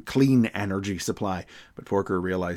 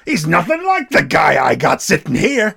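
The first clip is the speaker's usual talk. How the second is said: louder, higher gruff scolding; exasperated chief; 6 semitones higher, 8 dB louder than usual. Retold or imitated. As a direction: snap at him in disbelief.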